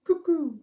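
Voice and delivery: high sung cuckoo call. Shown as a demonstration. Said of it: "And a cuckoo goes".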